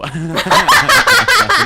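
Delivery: high, loud laugh through it. Real, not acted. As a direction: dissolve into laughter. Laughs off vague memory.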